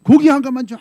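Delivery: loud, high, curt command to a butcher; rude order. Retold or imitated. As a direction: bark the order down at him. (반말로 크게 내지르는 무례한 주문)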